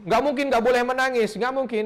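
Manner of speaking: usual speaking voice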